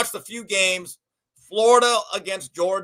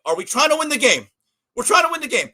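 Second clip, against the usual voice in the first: urgent plea; 6 semitones higher, 4 dB louder, faster than usual. Retold or imitated. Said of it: "He goes".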